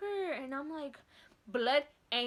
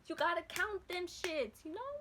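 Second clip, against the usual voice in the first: ordinary talk, nothing marked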